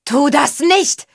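high, drawn-out urgent yell; bellowed warning. A narrator's reading. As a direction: yell up to stop someone. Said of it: "brüllte sie". (gedehnt gebrüllte Warnung)

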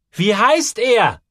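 very slow, high, loud call. A narrator's reading. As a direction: call across the boiler room. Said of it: "rief Joel". (laut und langsam gerufen)

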